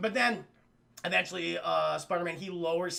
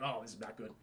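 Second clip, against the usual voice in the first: much lower, much softer dismayed grumble; gloomy villain; 10 semitones lower, 11 dB quieter, faster than usual. Retold or imitated. Kingpin seeing his gang fall apart, deep and low. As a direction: sink into a deep defeated grumble.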